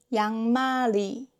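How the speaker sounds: 양말이 joined up as yang-ma-ri; linked pronunciation. Shown as a demonstration. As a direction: say 양말이 with ㄹ opening the last syllable. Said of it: "becomes 양말이".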